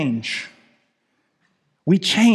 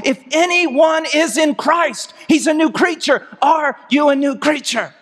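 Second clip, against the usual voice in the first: high, impassioned pulpit cry, slowing on key phrases; full-force preaching; 7 semitones higher than usual. Real, not acted. Preacher challenging his congregation on being new creatures, full force. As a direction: drive each phrase home to the congregation.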